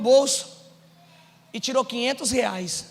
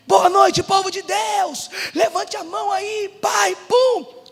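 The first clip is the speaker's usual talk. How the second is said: high, punchy preacher's welcome; hyped church greeting; 6 semitones higher, slower than usual. Retold or imitated. Pastor greeting the congregation while broke inside, hyped-up welcome. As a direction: pump up the congregation with a big hello.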